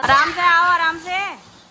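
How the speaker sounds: loud, high call to come slowly. Real, not acted. Mother calls to toddler to come carefully, loud high call. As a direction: call out gently but loudly, be careful.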